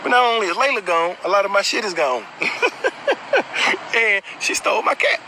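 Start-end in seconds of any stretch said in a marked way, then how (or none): 2.3-3.9 airy disbelieving laugh; a silent wheezing laugh at the memory; breathless laugh; breathy high laugh. Real, not acted. Laughs at cat theft.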